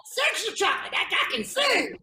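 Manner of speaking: movie quote. Retold or imitated. Quoting Coming to America to friends, high hammed-up imitation. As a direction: mimic an excited movie character. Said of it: "it's from Coming to America, where they're like".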